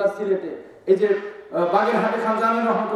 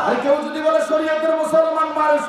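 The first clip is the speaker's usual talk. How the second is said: full-force preaching; 7 semitones higher than usual. Acted. Preacher listing shrines nobody should bow at, full-force preaching. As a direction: hammer each phrase out to the crowd.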